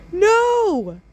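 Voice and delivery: very high squeaky shout; hammed-up high banana shout; banana cry. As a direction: shriek like a tiny banana. Banana cries out.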